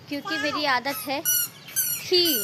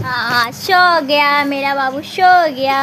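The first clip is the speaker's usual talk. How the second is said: lulling; 10 dB louder, slower than usual. Real, not acted. Mother pretending the baby has fallen asleep, slow lulling coo. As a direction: drag it out like a lullaby.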